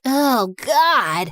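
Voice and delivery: usual speaking voice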